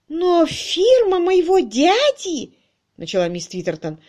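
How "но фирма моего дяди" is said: high, loud, slow wail; distressed protest. A narrator's reading. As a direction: protest in drawn-out dismay.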